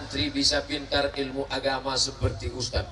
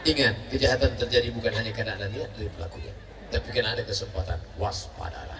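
fast, lower, softer announcer read; TV crime-host parody; 7 semitones lower, 4 dB quieter, faster than usual. Acted. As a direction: rattle it off like a crime show host. Quoting TV crime-show catchphrase. (parodi pembawa acara kriminal)

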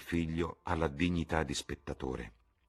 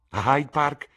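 A Hyde Park? much higher and louder, rising on Park; excited question; 10 semitones higher, 10 dB louder, slower than usual. A narrator's reading. Sibyl proposing Hyde Park to Jim, excited.

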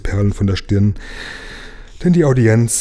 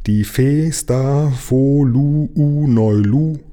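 very slow, higher, each syllable held; pompous repetition; 6 semitones higher, 4 dB louder, much slower than usual. A narrator's reading. King repeats the abbreviation for puzzled Lukas, pompous and drawn out. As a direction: spell it out grandly, syllable by syllable.

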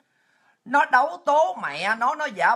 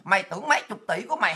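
ordinary talk

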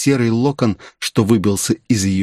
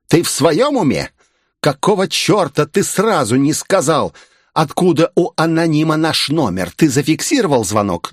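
higher-pitched, indignant questioning; angry scolding; 6 semitones higher than usual. A narrator's reading. Mistress berating her aide over delayed news, angry scolding. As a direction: fire off outraged questions at a subordinate.

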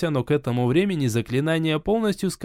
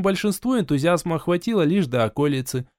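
ordinary delivery, nothing marked

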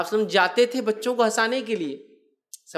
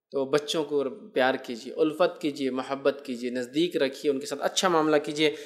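put-on soft, tender plea to love children; gentle advice; 6 semitones lower, 5 dB quieter than usual. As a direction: lower and softer, coaxing the listeners. (نرم اور شفیق لہجے میں نصیحت)

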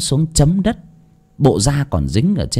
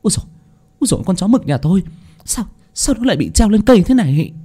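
fast, higher, flustered wailing; panicked cry; 10 semitones higher, faster than usual. Acted. Bà Xuân finding her dog hanged, crying out in panic. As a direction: babble in shock over the dead dog. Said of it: "bà hốt hoảng".

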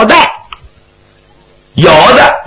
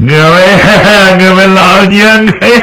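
wailing crying; 4 semitones higher than usual. Acted. Fang Shu'an kneeling before the memorial tablets, wailing. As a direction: cry out to the fallen, breaking into long sobs. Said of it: "他这一哭啊".

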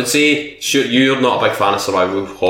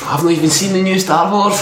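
ordinary delivery

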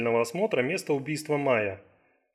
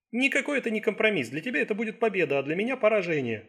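higher-pitched, mildly indignant objection; firm refusal; 7 semitones higher than usual. Acted. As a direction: turn the offer down, pitch raised. Assistant firmly refuses the patient's plea.

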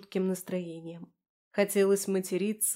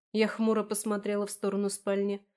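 ordinary delivery

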